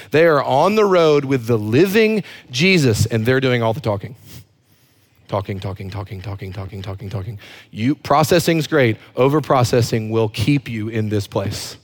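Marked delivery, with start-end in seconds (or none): 5.3-7.4 very soft, low run-on patter; nonstop muttering. Retold or imitated. Preacher mimicking disciples doing all the talking, low muttered loop. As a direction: mutter it on a loop under your breath.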